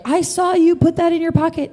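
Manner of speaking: high-pitched retold yell, no real volume; mock yell. Store clerk calling out a suspected thief, high mock yell. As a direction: imitate an accusing shout, pitched well up. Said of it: "starts yelling at Sean".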